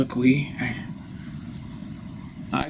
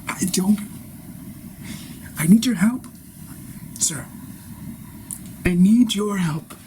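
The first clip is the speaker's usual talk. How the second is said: desperate plea; 6 semitones higher than usual. Acted. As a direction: beg him for help, voice straining. Guru cries out for help.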